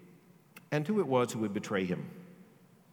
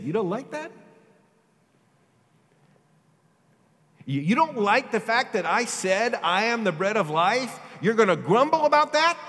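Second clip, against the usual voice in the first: rising, louder, higher challenge; incredulous challenge; 8 semitones higher, 6 dB louder than usual. Acted. Jesus rebuking the grumbling crowd, incredulous challenge. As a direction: throw the question back at them.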